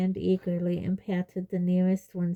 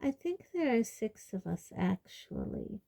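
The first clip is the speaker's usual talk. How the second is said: soft, higher-pitched mild correction; calm; 3 semitones higher, 6 dB quieter than usual. A narrator's reading. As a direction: point it out softly and calmly. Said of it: "said Luna calmly".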